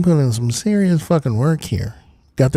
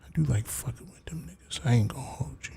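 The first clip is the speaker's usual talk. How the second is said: whispered aside; 10 dB quieter, faster than usual. Real, not acted. Confiding aside to viewers.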